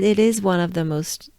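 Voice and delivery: normal talking voice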